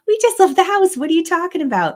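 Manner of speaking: husband reacting to the kids wanting snacks, mock incredulous. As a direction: play the baffled husband, half-laughing. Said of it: "My husband would say".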